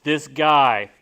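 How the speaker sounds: loud, delighted "this guy" from the husband; impressed husband calling out; husband's excited praise. Retold or imitated. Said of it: "the husband said".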